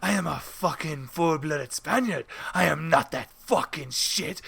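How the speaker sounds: slow, indignant mock Spanish accent; imitated proud Spaniard's accent, affronted; David. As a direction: declare it slowly in a put-on Spanish accent. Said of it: "He goes".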